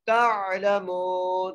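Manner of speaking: very slow chanted तालमून with ain joined to ta; Quranic recitation. Shown as a demonstration. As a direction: draw out तालमून syllable by syllable. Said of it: "ता को आइन से मिलाएंगे".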